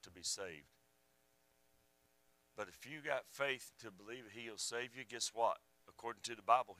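low, soft sermon close; hushed pastoral invitation, pausing between thoughts; solemn altar appeal. Real, not acted. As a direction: bring it down low and quiet to invite them. Soft closing appeal to the congregation.

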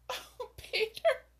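can barely speak for laughing; gasping with laughter. A narrator's reading. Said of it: "she gasped".

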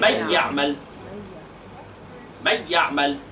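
"من يعمل" as "me yaʕmel", nun and its hum both gone; nun dropped with no nasal trace; wrong way, no ghunna. Shown as a demonstration. Teacher demonstrates the wrong reading, with no nasal hum. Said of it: "لو كانت النون راحت ولم يبقى أثرها".

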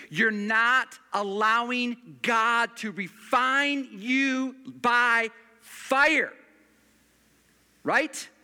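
loud, slow, drawn-out preaching; fiery pulpit preaching, slow and loud; full-force preaching. Acted. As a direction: lean hard on "by fire". Pastor preaches on refining fire.

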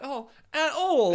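hammed-up annoyed British singer, laughter creeping in. Singer getting annoyed with her kid, mock-annoyed. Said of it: "[name] getting annoyed with her kid".

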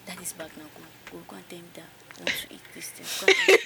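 whispered, hurried instructions; hushed mom's whisper. Retold or imitated. Mom secretly sending her child, hushed whisper. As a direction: murmur it low like a mom plotting. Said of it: "So you hear my mom or my elder sibling".

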